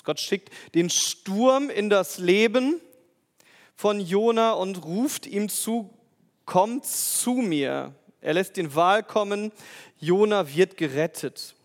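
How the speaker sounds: loud, slow, emphatic sermon reading; full-force preaching. Acted. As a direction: proclaim it loudly to the congregation. Preacher proclaims, louder and slower. (laut und langsam predigend)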